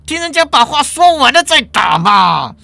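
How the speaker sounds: law department chair. Acted. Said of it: "法律系主任把他压下来，站起来说".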